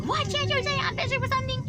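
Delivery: high-pitched doggy-talk reply; distracted reply to dog. Acts self answering the barking dog.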